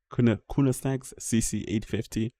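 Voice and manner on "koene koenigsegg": "Koenigsegg" restarted, landing on KOO-nus-nags; halting pronunciation attempt. Real, not acted. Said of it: "cannot say that name".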